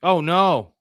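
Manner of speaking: sudden loud groan of dismay; real shock at losing the pick. Real, not acted. Rival drafts his player.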